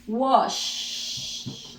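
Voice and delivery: "Wash" with a long hushing SH; voiceless final SH. Shown as a demonstration. Teacher isolating the verb's last sound, voiceless final SH. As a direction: hold the SH at the end of "Wash". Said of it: "Last sound, sh, voiceless".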